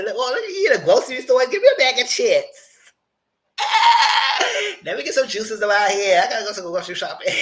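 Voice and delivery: high, loud, hammed-up glee; playful high-pitched mock raid on snacks; mock excited shopper. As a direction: clown around as a greedy shopper. Clowning as a hungry store raider.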